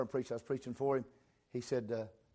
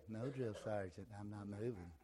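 meek reply; 4 semitones lower, 11 dB quieter than usual. Retold or imitated. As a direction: mumble it like a scared recruit. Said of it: "You wouldn't say".

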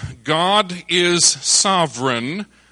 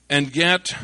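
usual speaking voice

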